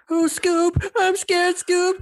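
very high scared cartoon voice. Shown as a demonstration. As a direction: squeak it out like a frightened cartoon. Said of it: "I can do".

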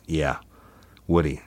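usual speaking voice

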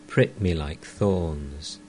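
plain reading voice

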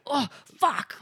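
exasperated outburst. Acted. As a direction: play up the frustration. Acts out how not to react.

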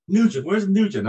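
loud, high bark of a name across the bus; retold drill instructor yell, pitched high and loud. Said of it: "He's yelling and screaming".

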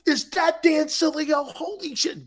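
high startled double take; surprised. Retold or imitated. Seeing him across the room, caught off guard. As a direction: gasp it out, way up high.

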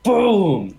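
high, loud, falling "boom"; explosive boom. Shown as a demonstration. Player showing off a power pose, booming exclamation. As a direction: punch out a big boom that swoops down.

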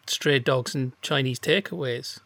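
ordinary talk

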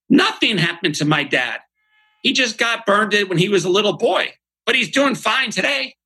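put-on high child voice, matter-of-fact; his daughter Grace. Daughter Grace telling her friend about dad, high child voice. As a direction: speak like a small girl explaining simply. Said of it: "looks at her friend and says".

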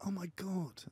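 high, soft gushing imitation; acted starstruck awe. Girl spotting Prince Harry, high soft mock awe.

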